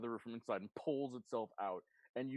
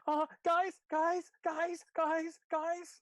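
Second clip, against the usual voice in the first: high, loud, frantic squeal; panicked squeal; 6 dB louder, much slower than usual. Acted. Blackberry calls the others to come, in a panicked squeal. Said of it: "Blackberry's panicked squeal".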